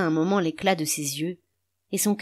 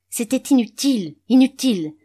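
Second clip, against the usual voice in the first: louder, higher, fed-up cry of despair; exasperated outburst; 6 semitones higher, 6 dB louder than usual. A narrator's reading. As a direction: let the frustration burst out. Despair at crying baby.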